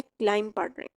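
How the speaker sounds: क्लाइंब said as klaim, no b at the end; silent b in climb. Shown as a demonstration. Teacher showing how to say क्लाइंब. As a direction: drop the b, just klaim. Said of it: "हम इसको क्लाइंब नहीं पढ़ रहे हैं".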